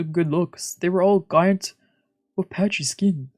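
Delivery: normal talking voice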